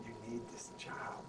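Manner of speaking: soft slow warning; quiet warning. Retold or imitated. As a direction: warn him under your breath. Francesca warns him quietly.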